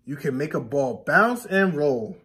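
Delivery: normal talking voice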